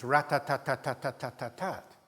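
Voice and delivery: machine-gun "rat-tat-tat", every syllable equally stressed; syllable-timed rhythm. Shown as a demonstration. Lecturer illustrating syllable-timed rhythm, evenly beaten. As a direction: rattle out "tat" in identical even beats. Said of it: "is called syllable-timed rhythm".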